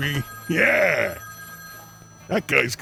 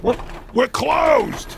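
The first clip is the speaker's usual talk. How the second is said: shouting; 7 semitones higher, slower than usual. Acted. Shouting at a late caller.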